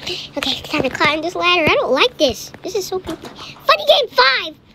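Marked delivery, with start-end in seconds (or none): 3.7-4.6 loud, high-pitched cry; alarmed shout. Real, not acted. Startled cry at new game.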